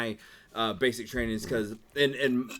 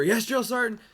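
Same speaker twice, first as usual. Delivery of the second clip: high, snappy recruit reply; barked military recruit reply; 10 semitones higher than usual. Retold or imitated. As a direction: answer like a startled private. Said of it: "look over".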